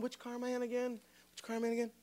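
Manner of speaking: very soft aside to himself; imitating a preoccupied man's mutter; absent-minded muttering. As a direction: mumble it under your breath, distracted. Distracted dad on the phone, muttering.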